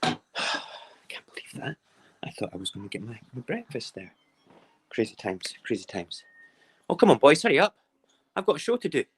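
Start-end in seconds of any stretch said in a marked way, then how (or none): 0.0-1.8 soft unvoiced whisper to himself; whispered disbelief. Real, not acted. 6.9-9.0 higher, louder playful hurry-up call. Real, not acted. Calling to the bin men outside.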